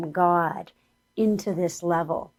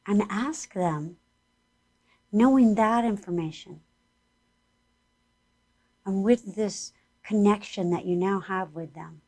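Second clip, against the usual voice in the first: guided meditation; 3 semitones higher than usual. Acted. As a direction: lead the listeners gently inward.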